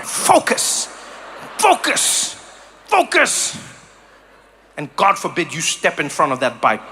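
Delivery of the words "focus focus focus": higher, terse "focus" with a sharp drop; mock-intense focus, one word at a time; stern rider staying locked in; serious Dutch cyclist. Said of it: "in such a serious way".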